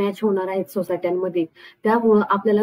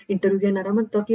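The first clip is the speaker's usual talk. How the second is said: plain reading voice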